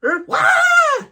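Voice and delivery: very high, loud wail of a tumble; mock falling scream. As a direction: yelp as if toppling downstairs. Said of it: "And then she falls down the stairs".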